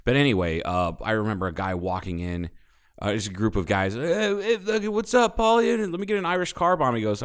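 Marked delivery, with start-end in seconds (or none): 3.9-6.9 high, loud jeering bro voice; mocking bro voice. Mimicking the rowdy customers ordering at the bar, high jeering bro voice.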